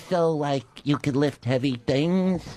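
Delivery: slow, tentative agent question; agent Dennis. Retold or imitated. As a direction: lightly imitate an agent cautiously checking a wild claim. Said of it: "He goes".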